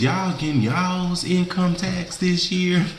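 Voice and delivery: high, slow teasing question. Real, not acted. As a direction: tease the viewers, drawn out.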